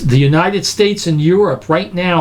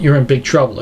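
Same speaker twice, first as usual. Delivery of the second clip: normal talking voice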